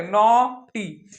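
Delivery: "नॉटी" stretched slowly on a rounded aw; drawn-out aw. Shown as a demonstration. Teacher has pupils voice the sound, slow "नॉटी" with long aw. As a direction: hold the aw in "नॉटी", then a separate tee. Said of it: "जब मुंह से बोलो तभी आएगा".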